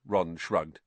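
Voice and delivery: ordinary delivery, nothing marked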